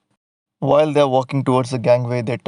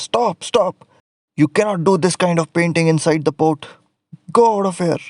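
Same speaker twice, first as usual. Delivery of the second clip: angry order; 5 semitones higher than usual. Acted. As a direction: stop him sharply, send him away. Inspector scolds the painter.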